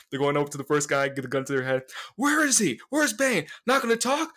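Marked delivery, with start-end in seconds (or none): 2.2-4.3 high, strained demanding questions; acted interrogation. Agent with a gun to hostage's head, demanding. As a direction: interrogate hostages in a raised, put-on voice.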